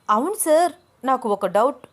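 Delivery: sudden startled outcry; sudden shout. Acted. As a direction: burst out as if a thought just struck her. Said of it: "గట్టిగా అరుస్తున్నట్టు అంది".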